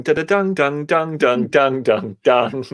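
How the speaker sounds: rhythmic sung-out banjo plucking; imitated banjo. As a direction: twang out a banjo riff with the mouth. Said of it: "would be a banjo playing".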